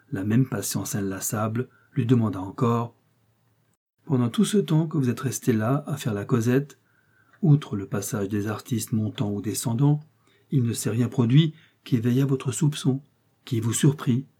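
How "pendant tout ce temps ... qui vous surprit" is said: usual speaking voice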